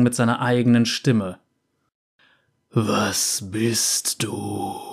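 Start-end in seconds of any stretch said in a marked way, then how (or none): 2.7-4.8 very slow, low, soft croaking monster; raspy croak. A narrator's reading. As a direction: drag out a raspy creature croak. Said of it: "krächzte sie".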